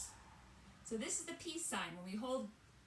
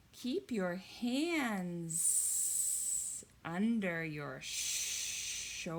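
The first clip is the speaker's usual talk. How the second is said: snake voice; 4 semitones lower, 4 dB louder, much slower than usual. Acted. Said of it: "And the snakes say".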